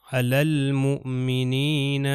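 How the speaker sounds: علی المؤمنین slowly chanted, lam sounded; Quran recitation. Shown as a demonstration. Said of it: "لام کو میں نے تلفظ کیا".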